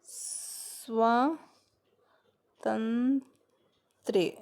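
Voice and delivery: स्वातंत्र्य stretched very slowly, each syllable spelt out; teacher dictating स्वातंत्र्य for notes; slow careful dictation. Shown as a demonstration. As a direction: dictate स्वातंत्र्य slowly so students can write it. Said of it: "तो इसको ऐसे लिखेंगे".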